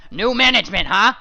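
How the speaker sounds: high, loud scoffing yell; husband scoffing at new management; sarcastic shout. Acted. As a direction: sneer it loudly. Husband sneering loudly.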